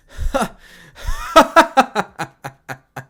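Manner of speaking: loud, high mocking cackle; menacing laugh. Issei laughing at his father, loud mocking cackle. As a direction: laugh at them with contempt.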